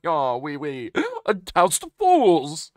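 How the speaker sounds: hammed-up French villain; loud French accent, last words booming and drawn out. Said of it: "Is he actually just French?".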